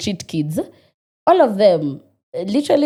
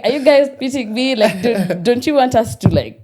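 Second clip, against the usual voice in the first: raised, louder, half-laughing plea; mock exasperation; 4 semitones higher, 4 dB louder than usual. To the salon guys, a laughing mock protest. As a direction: complain to the staring guards, amused. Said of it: "it's like".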